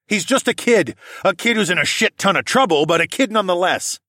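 high, loud protest; heated defence. A narrator's reading. Parent pleading their son's case, heated and loud. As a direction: argue forcefully for the kid.

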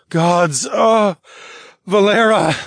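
loud groan, pitched well up; loud, strained exclamation. A narrator's reading. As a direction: groan the name out, overcome. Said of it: "I groaned".

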